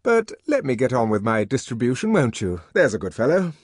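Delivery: propitiating. A narrator's reading. Deputy sub-bursar coaxing the savage, propitiating. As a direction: soothe him into giving way. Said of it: "smiling propitiatingly".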